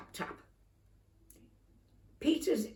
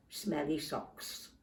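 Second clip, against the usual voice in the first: softer, slower secret word; sly aside; 4 semitones lower, 7 dB quieter, slower than usual. A narrator's reading. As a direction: let the password slip out. Password said sly.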